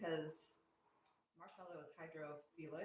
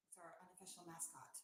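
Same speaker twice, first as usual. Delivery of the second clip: normal talking voice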